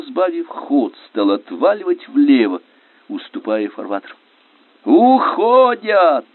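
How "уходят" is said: high, long-drawn cry of triumph; elated shout. A narrator's reading. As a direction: yell it out in delight. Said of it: "восторженно кричал минёр Виноградов".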